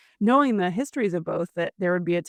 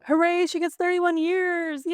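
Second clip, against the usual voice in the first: mock cheering; 11 semitones higher than usual. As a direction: cheer it in a high, put-on voice. Mock celebration of a long sentence.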